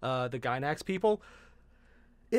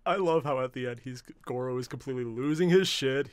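normal talking voice

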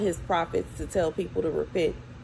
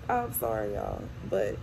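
tearful apology; 9 semitones lower, 5 dB quieter than usual. Real, not acted. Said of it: "and I'm crying now".

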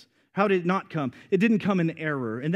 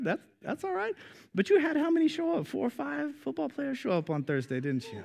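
higher, playful, teasing Carson; 8 semitones higher, 4 dB quieter than usual. Real, not acted. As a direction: rib him gently, pitched up. Teasing a listener by name.

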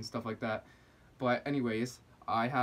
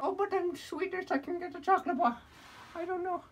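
squeaky falsetto fake Swedish accent; badly faked Swedish whine; mock Swedish voice. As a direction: plead as a very high-pitched silly Swede. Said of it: "I don't know what Swedish people sound like".

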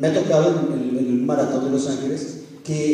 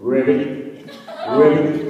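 a light croaky English frog call, imitated; gringo frog ribbit; 2 semitones lower, much slower than usual. Preacher showing the church how young people imitate a frog, mock ribbit. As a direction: mimic an American frog's ribbit. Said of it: "ese sonido de una rana gringa".

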